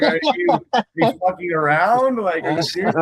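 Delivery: high-pitched, baffled retelling; amused, incredulous protest; mock incredulity. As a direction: imitate someone who can't believe it. Retold baffled reply to her scolding.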